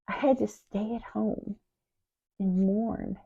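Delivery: soft, near-whispered mourning; hushed grief. Acted. Sister stayed home to mourn, hushed grief. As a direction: withdraw into a small, hushed voice.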